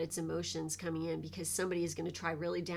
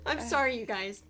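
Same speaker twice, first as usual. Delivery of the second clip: emotional apology; 2 semitones higher, 4 dB louder than usual. Real, not acted. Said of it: "I can't even do this".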